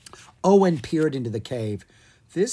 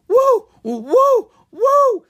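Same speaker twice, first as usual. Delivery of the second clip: very high, loud whooping build-up before a sneeze; sneeze build-up yell; 10 dB louder, much slower than usual. Acted. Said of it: "he yelled".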